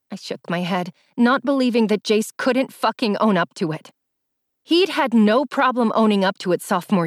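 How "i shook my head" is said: ordinary delivery, nothing marked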